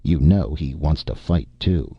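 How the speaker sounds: usual speaking voice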